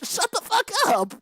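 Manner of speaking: high, shrieked angry yell; yelled at the screen. Retold or imitated. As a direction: scream at the character in disbelief. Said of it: "I'm like".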